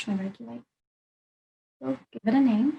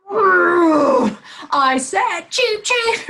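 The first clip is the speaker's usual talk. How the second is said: much higher and louder, a fed-up little bird; insistent bird; 8 semitones higher, 9 dB louder, much slower than usual. Acted. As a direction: squawk it like the ignored bird demanding attention. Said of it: "that bird is like".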